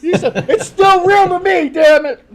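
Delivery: loud slow high fanboy shout; wrestling fan shout. Acted. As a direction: yell it like a devastated wrestling fan. Mock wrestling fan outburst.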